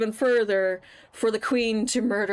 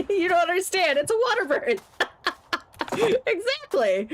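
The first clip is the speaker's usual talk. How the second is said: higher, giggling through the words; laughing; 10 semitones higher than usual. Real, not acted. Host cracking up while explaining the water bird, laughing. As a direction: laugh it out helplessly.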